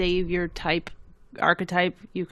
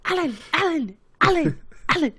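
high-pitched repeated name calls; imitated calling out; 9 semitones higher than usual. Quoting a YouTube video for a laugh, mock calling out. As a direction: mimic someone calling a name over and over.

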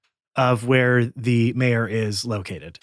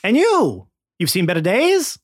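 much higher, louder huckster voice; slick salesman; 8 semitones higher, 5 dB louder than usual. Acted. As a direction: call out like a carnival pitchman. Scam Likely speaking.

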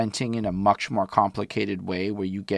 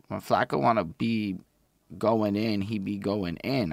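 ordinary talk

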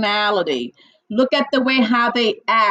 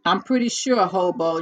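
ordinary talk, nothing marked

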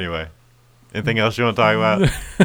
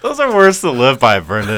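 sarcastic; 3 semitones higher, 7 dB louder than usual. Pretend. Mock praise of lyrics.

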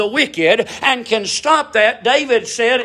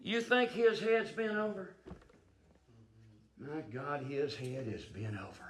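far softer and lower, almost breathed; quiet sorrowful aside; hushed aside; 9 semitones lower, 19 dB quieter than usual. Acted. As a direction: pull back to a hush.

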